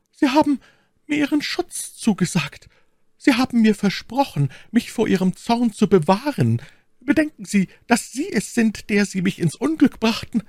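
very high, urgent maid's voice; aufgelöste Ketty. Acted. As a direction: plead in a high voice, reproaching him. (aufgelöste, sehr hohe Mädchenstimme)

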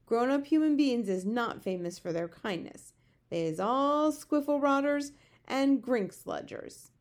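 animated giant, drawing out the odd names; storybook giant, scornful. A narrator's reading. Giant disparages grown-up humans, scornful.

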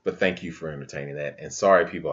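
ordinary talk

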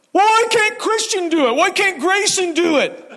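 put-on high, whiny child protesting; whiny kid voice. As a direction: whine like a kid dodging chores. Kids whining about chores.

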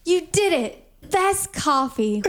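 hammed-up Buddy the Elf cheer; Elf quote. Congratulating the diner staff like Elf, gushing and slow. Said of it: "he's like".

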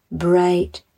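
usual speaking voice

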